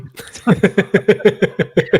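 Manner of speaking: high bubbling laugh; laughing at her own joke. Real, not acted. Host laughs at visiting in the cold. Joking about minus twenty-five.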